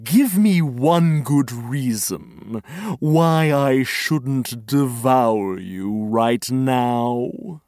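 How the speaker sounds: slow, low menacing lion growl. A narrator's reading. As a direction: loom over her, slow and deep. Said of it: "and said".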